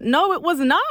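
high, loud indignant no; indignant denial. Retold or imitated. Arguing with the officer, high and loud indignant. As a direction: insist hotly it was not red.

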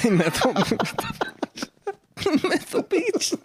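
"methyl beach" with a lisped th-sound for s, laughing; lisping "methyl beach" while laughing high. Shown as a demonstration. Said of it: "Sorry for the lisp".

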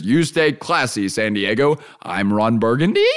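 upward questioning lilt at the end; Ron Burgundy questioning. Retold or imitated. Host retells anchor reading a typo question mark, rising tune. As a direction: read the sign-off as if unsure. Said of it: "put a question mark in the teleprompter".

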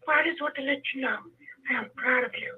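hoarse, breaking voice fading to a whisper; weak voice that drops to a whisper; voice going in and out. Real, not acted. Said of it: "you have to excuse my voice".

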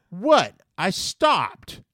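high, loud mock-innocent comeback; defensive protest. Skateboarding kid answering the angry customer, defensive protest. Kid's defensive reply, acted.

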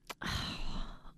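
long breathy sigh; resigned exhale, mimicked; imitated child's sigh. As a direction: sigh as if giving in.

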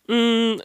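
high rising thinking hum. Retold or imitated. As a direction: hum as if stalling for time. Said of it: "she just goes".

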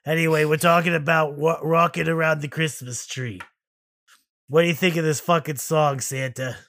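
loud, surly begrudged elf; bitter laid-off elf snapping; grumpy elf. Acted. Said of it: "begrudged, laid off elf".

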